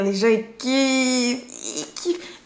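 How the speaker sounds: stretched-out words climbing into a high squeak; gushing emphasis. Real, not acted. Telling viewers people love the accent, gushing. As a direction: drag out the verb, then squeak it.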